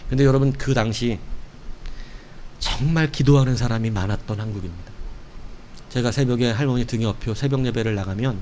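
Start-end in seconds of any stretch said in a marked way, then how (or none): none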